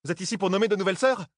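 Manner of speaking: fast, high-pitched incredulous question; exclaiming. A narrator's reading. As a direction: fire the question out, high and quick. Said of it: "s'écria Doessine". (question exclamée, rapide et aiguë)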